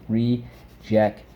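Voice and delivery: rejection slowly broken into re... jec... tion; syllable by syllable. Shown as a demonstration. Teacher repeating rejection for the class, syllable by syllable. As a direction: pause between the syllables of rejection. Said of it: "Let's go".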